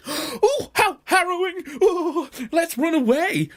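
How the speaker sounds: high hammed-up fright; mock panic. Mother goat spotting the wolf, high mock panic. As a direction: melodramatic gasping alarm.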